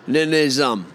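normal talking voice

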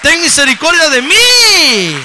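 loud, slow, high anguished plea; pleading cry. Retold or imitated. A believer on their knees begging God for mercy, loud and high. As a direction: cry out for mercy at full force.

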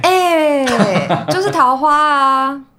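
teasing laugh. Real, not acted. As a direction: tease her slowly through a laugh. Teasing friend about old romance.

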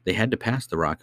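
usual speaking voice

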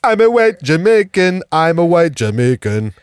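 loud, slow mock Jamaican accent; put-on Jamaican accent. Someone faking foreign accent syndrome, mock Jamaican accent. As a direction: lay on a broad Jamaican accent, drawn out. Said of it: "they wanna do a voice in polite society".

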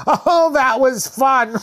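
high, drawn-out gleeful voice; laughing woman. Retold or imitated. Woman after flattening the boy, high and gleeful. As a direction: imitate her oblivious delight. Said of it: "And I'm hearing".

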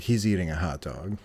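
low, soft and offhand; nonchalant. Retold or imitated. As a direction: imitate someone casually stating a fact.

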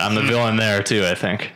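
ordinary talk, nothing marked

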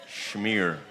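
smear said as shmear with sh; joking Yiddish shmear. Shown as a demonstration. Pastor jokes to church, smear as shmear.

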